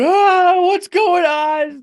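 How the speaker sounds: high, loud drawn-out mock scream; panicked passengers. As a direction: imitate passengers yelling in turbulence panic. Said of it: "people are going like".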